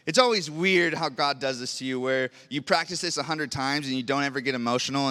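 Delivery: ordinary talk, nothing marked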